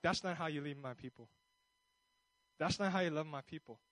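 gentle God rebuke. Acted. God correcting the pastor's temper, calm low rebuke. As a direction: bring it down to a grave reproof.

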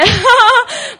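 a loud burst of laughter that falls away. Real, not acted. Retelling dad's emo text, a loud laugh. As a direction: break into a big laugh mid-story. Laughing while quoting dad.

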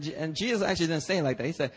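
ordinary talk

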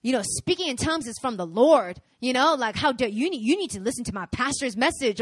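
loud, indignant back talk; mock attitude. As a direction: play a defiant church member. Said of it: "with that kind of attitude".

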